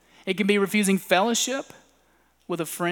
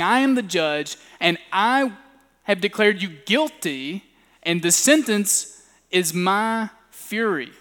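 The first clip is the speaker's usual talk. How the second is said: louder mock verdict; an angry self-made judge, loud; judging fury; 5 dB louder than usual. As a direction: pronounce judgment in wrath. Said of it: "you are saying".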